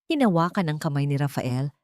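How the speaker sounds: plain reading voice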